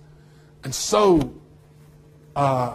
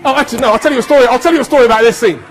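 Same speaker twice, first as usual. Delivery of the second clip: loud, high voice over playback; calling out; 8 semitones higher, 10 dB louder, faster than usual. Real, not acted. Guest interrupts the clip to offer a story, calling out. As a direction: raise the voice high to talk over sound.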